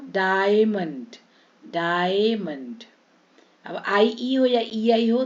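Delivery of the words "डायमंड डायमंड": "डायमंड" slowly as daa-ye-mand; stretched ia. Shown as a demonstration. Teacher modelling i-a sound, slow and stretched. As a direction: draw out the first vowels of "डायमंड". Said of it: "आई ए को".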